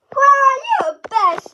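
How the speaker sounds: loud, high joyful cry of thanks; excited shout. Acted. Kid thanking grandma for birthday money, thrilled shout.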